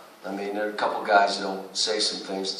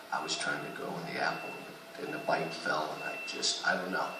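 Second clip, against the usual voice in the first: low, soft sheepish mumble; mumbling excuse; 6 semitones lower, 14 dB quieter than usual. Retold or imitated. Coach mocking a player mumbling an excuse, low and soft. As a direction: make a lame excuse under your breath. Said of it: "Why didn't you block him out".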